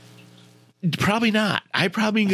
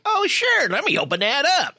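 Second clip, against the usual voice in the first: sarcastic; 8 semitones higher than usual. Retold or imitated. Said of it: "would not be like".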